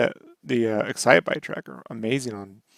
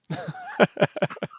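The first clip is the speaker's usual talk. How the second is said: high-pitched laugh; 5 dB quieter than usual. Real, not acted. Laughing at the joke.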